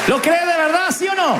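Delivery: high ringing call to the crowd; rousing challenge. Real, not acted. Preacher calling out to the church, high and ringing. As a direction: fire the question at them.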